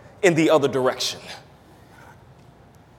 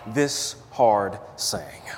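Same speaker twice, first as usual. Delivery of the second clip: whispered; 6 semitones lower, 5 dB quieter, much slower than usual. Real, not acted. Preacher drops voice on phrase.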